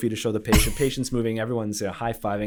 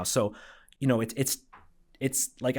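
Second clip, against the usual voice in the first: ordinary talk, nothing marked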